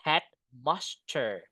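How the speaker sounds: Headmaster said slowly and clearly, each syllable drawn out; slow model word. Shown as a demonstration. Teacher modelling Headmaster slowly for the class. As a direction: spell out Headmaster slowly for learners. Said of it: "bukan head school ya".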